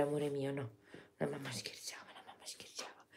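soft unvoiced whisper to a pet. Real, not acted. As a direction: hush it to a dozing dog. Whispering to the dog.

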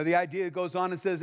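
usual speaking voice

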